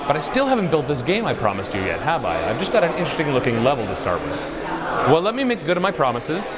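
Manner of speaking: ordinary talk, nothing marked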